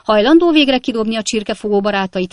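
loud, high landlady's challenge; indignant shout; angry demand. A narrator's reading. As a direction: demand action from the lodger. Landlady yells at her lodger.

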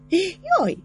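short, very high startled shriek. A narrator's reading. Rozáli shrieks as Fabricius lifts her. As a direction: yelp as she is swept up. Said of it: "sikított Rozália".

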